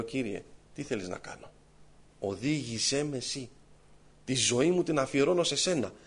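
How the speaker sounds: soft, humble plea to the Lord, slowed; quietly surrender, asking for guidance; a hushed, humble prayer to God. Retold or imitated. Praying to God in distress.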